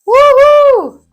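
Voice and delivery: big high loud woohoo; excited whoop. Shown as a demonstration. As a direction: whoop with pure delight. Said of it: "Can we all do that together?".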